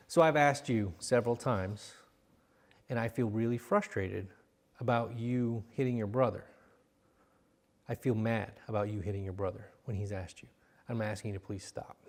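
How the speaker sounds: put-on quietly serious with a child; calm serious. Shown as a demonstration. Coach showing a parent calm seriousness, low and soft. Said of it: "a whole lot of seriousness right there".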